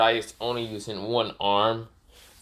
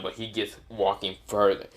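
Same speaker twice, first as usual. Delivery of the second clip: usual speaking voice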